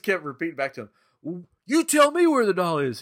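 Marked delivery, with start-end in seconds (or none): 1.2-3.0 mock interrogation.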